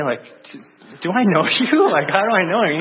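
embarrassed disbelief; baffled laughing question. Retold or imitated. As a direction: do I know you, rising high through a laugh.

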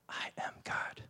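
hushed whispered aside; preacher whispering the key point to the congregation. Real, not acted. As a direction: drop to a whisper for the reveal. Whispered aside after verse.

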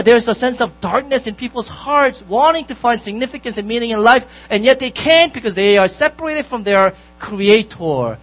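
loud, ringing sermon delivery; preaching at full force. Acted. Pastor exhorting his congregation, loud and ringing. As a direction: preach it out with fire to the whole room.

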